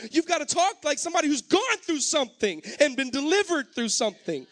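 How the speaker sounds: fervent sermon exhortation; impassioned preaching. Real, not acted. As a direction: press the congregation urgently, climbing as you go. Preaches at rising force.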